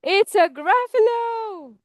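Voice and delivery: high, drawn-out cry of alarm; storybook mouse in fright; frightened mouse. Acted. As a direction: stretch it out high like a mock wail. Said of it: "So he says".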